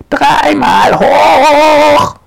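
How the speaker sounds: very high, slow, loud cheer; little witches bawling a cheer. Acted. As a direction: roar it out. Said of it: "brüllen die Hexchen".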